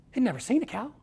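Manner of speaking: ordinary talk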